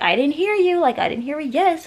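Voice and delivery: high, loud mock insistence; exaggerated retold challenge; demanding boyfriend. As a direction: push it up high, imitating his demand. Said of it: "And he was like".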